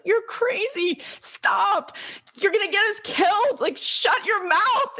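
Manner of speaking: high, urgent retold outcry; alarmed scolding. Everyone warning young Doctor King to stop, alarmed scolding. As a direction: shut him down, stretching the final order.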